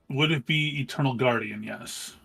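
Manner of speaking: ordinary delivery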